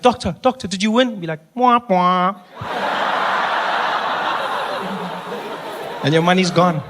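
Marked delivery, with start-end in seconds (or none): 0.0-2.4 higher playful mimicry; a hint of a smug doctor; mock doctor exchange. 2.6-6.0 wheezing laugh; high breathy laugh. Real, not acted. Laugh after joke.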